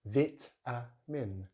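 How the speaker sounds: usual speaking voice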